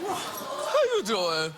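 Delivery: very slow, stretched how you doing; slow catchphrase. Shown as a demonstration. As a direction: let the greeting linger, drawn out. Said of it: "catchphrase drawn out".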